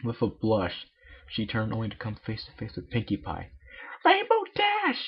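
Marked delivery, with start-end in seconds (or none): 4.0-5.1 very high, drawn-out yell; high-pitched pony shouting a name; Pinkie's shout. Acted. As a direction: startle her friend with a squeal. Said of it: "she shouted".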